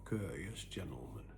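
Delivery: ordinary delivery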